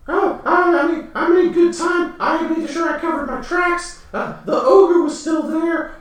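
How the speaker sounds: very high, pleading little goblin voice; whiny high-pitched goblin begging his boss; panicky goblin. Acted. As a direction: squeak out excuses like a cowering goblin. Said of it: "goblin answers the werewolf".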